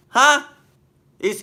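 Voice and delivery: normal talking voice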